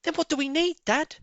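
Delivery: put-on a boy fox asking his dad; young fox cub. A narrator's reading. Small fox asking where the turkeys are.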